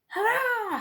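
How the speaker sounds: high, loud gleeful hurrah; high loud triumphant cry; excited cheer. Acted. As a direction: cheer like a delighted spider. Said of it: "he said".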